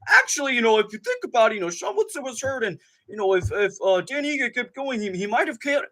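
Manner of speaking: high, know-it-all commentator impression; mocking DC voice. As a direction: lecture like a smug pundit. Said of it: "D C starts going".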